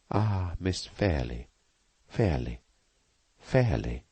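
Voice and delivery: slow, softening repetition of the name; tender, fading. A narrator's reading. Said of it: "seemed to quiet her".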